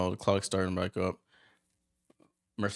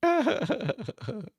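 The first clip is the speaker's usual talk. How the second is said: high-pitched giggle; tickled by the quarrel; laughing at the scuffle; 13 semitones higher than usual. Real, not acted.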